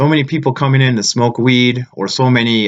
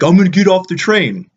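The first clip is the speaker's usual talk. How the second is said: mocking threat, higher and louder; imitating aggressive guy; 7 semitones higher, 3 dB louder than usual. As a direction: ham up the bully's challenge. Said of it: "And he's like".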